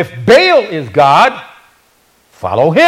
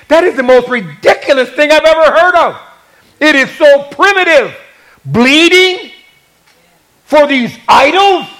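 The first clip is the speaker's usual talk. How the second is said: incredulous scorn; 7 semitones higher than usual. Acted. Acted scornful reaction to idol worship.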